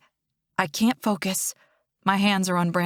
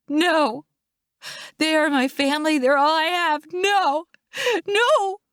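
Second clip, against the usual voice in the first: high, distraught crying out; desperate, grief-stricken shouts; anguished protest; 8 semitones higher, 3 dB louder than usual. A narrator's reading. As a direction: refuse to be pulled away, wailing. Fighting paramedic pulling her away.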